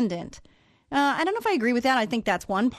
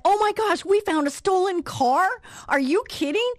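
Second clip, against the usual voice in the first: excited disbelief; 10 semitones higher than usual. Real, not acted. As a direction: gasp it out, thrilled and stunned. Learning car was stolen.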